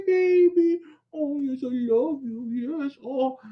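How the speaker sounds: high, slow cooing to the puppy. Real, not acted. Owner cooing at the puppy Sasha on camera.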